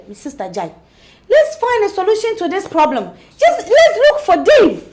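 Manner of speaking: loud, high urging of a friend; urgent urging. Acted. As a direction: push her to act now. Friend urging action loudly.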